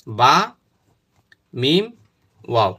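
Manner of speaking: বা, মিম, wow said one at a time with long pauses; slow letters. Shown as a demonstration. Teacher sounds the lip letters for students, slow and spaced. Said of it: "দেখেন".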